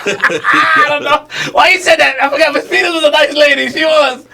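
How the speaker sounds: high, laughing, half-shouted disbelief; cracking up as he protests she was a nice lady. Real, not acted. Friend laughing in disbelief at his own teacher story.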